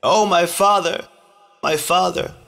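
high, drawn-out lament for a father; wailing, almost chanted mourning call; bitter cry. A narrator's reading. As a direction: call out in bitter grief, pitch lifted well up. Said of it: "called out in a bitter voice".

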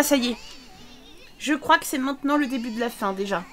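normal talking voice

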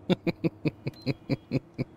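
high chuckle; long run of amused chuckles; laugh. Real, not acted. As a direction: giggle at a silly title. Amused at a title just read.

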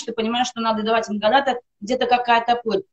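normal talking voice

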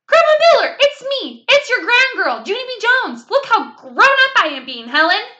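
put-on eager child showing off to her grandma; excited little girl. A narrator's reading.

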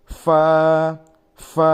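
loud ফা with a puff of air; breathy ফা. Shown as a demonstration. Teacher showing the airy ফা. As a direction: push air out on ফা. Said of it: "এইভাবে উচ্চারিত হবে".

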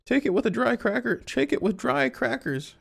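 very high put-on falsetto voice; high-pitched character. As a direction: read it way up in a squeaky-high character voice. Reads a character's lines in falsetto.

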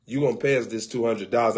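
usual speaking voice

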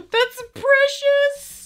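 very high, loud, drawn-out squeal; gushing delight. Real, not acted. Vlogger gushing over a gifted book, delighted squeal. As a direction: squeal it slowly, melting over something cute.

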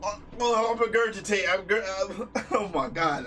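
ordinary delivery

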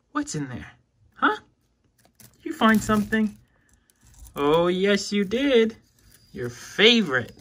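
high, loud praise for a pet; gushing loudly at the lizard; excited praise. Real, not acted. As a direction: cheer her on, delighted. Cooing excitedly at feeding lizard.

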